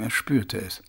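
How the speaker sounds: ordinary delivery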